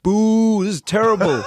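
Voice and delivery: put-on loud, high drunken booing; drunk heckling. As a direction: boo him off like a drunk heckler in the crowd. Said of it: "he starts heckling".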